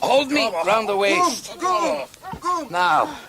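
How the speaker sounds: high, loud instructions; calling out. Acted. Man instructing passenger as camel rises, calling out. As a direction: shout directions over the commotion.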